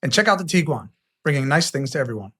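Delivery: punchy sponsor plug; ad read. Acted. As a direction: sell it like a radio spot.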